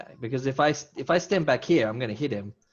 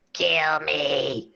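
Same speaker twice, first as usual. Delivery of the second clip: higher, drawn-out mock creature cry; screaming slime creature; 5 semitones higher than usual. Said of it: "it spawns in and goes".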